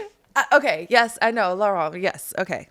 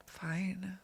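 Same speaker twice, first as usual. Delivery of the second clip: whisper; 13 dB quieter than usual. Pretend. Mock-sulky reply.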